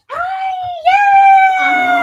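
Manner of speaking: very high, loud cheer; long, high-pitched happy squeal; excited squealing. Real, not acted. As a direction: squeal a delighted greeting. Celebrates and greets during livestream.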